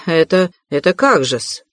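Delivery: plain reading voice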